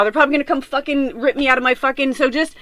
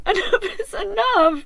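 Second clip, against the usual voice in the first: softer, a broken laugh through the words; laughing despair; 6 semitones higher, 3 dB quieter than usual. Real, not acted. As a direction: laugh helplessly as you say it.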